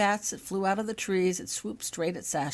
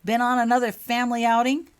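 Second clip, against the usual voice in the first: higher, louder mocking question; sneering jibe; 7 semitones higher, 10 dB louder than usual. A narrator's reading. Tom mocking the dirty group, sneering. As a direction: needle him with a smug sneer. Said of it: "voiced Tom Richards with a smirk".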